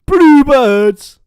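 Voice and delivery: loud, high shout of the nickname; joyful shout. Real, not acted. Fan shouting the club nickname to chat, loud and happy. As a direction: bellow it for the club.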